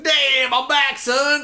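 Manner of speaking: hammed-up triumphant yell; triumphant mock exclaim. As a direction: crow it like a big comeback. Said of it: "I'm just like".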